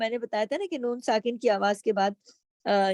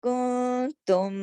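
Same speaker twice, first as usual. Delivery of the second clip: drawn-out recitation; slow recitation; much slower than usual. Shown as a demonstration. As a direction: recite slowly. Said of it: "slow recitation". (آہستہ تلاوت)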